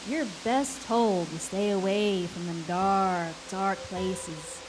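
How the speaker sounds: ordinary delivery, nothing marked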